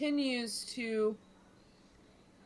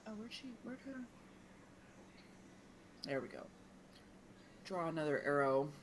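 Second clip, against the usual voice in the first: ordinary delivery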